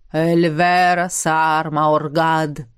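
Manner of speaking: booming slow incantation; echoing ethereal energy being. A narrator's reading. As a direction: intone the spell slowly and deep. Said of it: "Гулкий голос Хеймдара". (гулкое заклинание, медленно)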